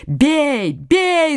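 urgent cry, rising on the repeat; pursuers' shout. A narrator's reading. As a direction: call out like a pack in pursuit. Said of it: "закричали преследователи".

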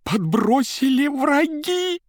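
high, slow desperate wail; panicked cry. Acted. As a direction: cry out as if framed. Panicked chairman denies planted money.

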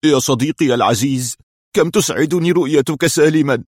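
overjoyed reunion cry; tearful exclamation. A narrator's reading. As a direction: cry out to a dear friend, emotion welling up. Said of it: "وهو يهتف".

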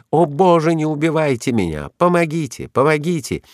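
high-pitched plea for help; terrified cries. A narrator's reading. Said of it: "неслись страшные крики". (испуганные крики о помощи)

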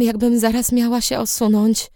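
ordinary delivery

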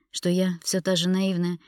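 ordinary delivery, nothing marked

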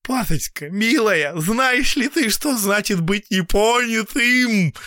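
high, loud, tragic plea; tragic pleading. A narrator's reading. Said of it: "трагически".

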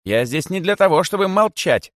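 loud, high retort from a rabbit; loud defiance. A narrator's reading. Rabbit refuses Kosoy's plea, loudly and defiantly. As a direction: speak up boldly, pitched high. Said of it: "громко сказал кролик".

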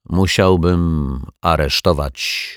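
usual speaking voice

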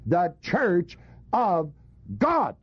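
"God" set apart after a pause, pitched very high; slow, spaced words building to a high "God"; stress on GOD. Shown as a demonstration. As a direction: hold back, then hit "God" high. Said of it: "emphasized it".

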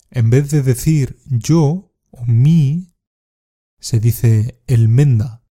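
slow, careful teacher explanation; slow, deliberate explanation of "el menda"; slow explaining. Shown as a demonstration. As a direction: spell it out slowly for learners. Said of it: "explains the word".

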